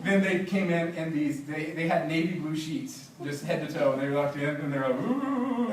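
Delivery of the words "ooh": high, hollow ghost moan; mock ghost wail under bedsheets; ghostly moan. Missionaries pretending to be ghosts.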